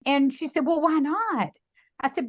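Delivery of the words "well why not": higher-pitched, puzzled challenge; acted puzzled challenge; taken-aback question. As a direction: question it with a high swoop. Mother-in-law's question repeated in acting.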